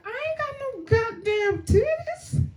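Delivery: very high outraged protest; indignant squeal. Retold or imitated. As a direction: shriek it in offended disbelief. Indignant retort to clerk.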